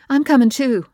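high, loud and firm; no one's stopping her; insistent mother. A narrator's reading.

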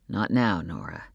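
low, fading to near silence; curt low father. A narrator's reading. Father cutting wife off.

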